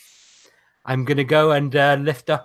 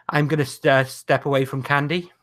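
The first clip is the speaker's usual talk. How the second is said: normal talking voice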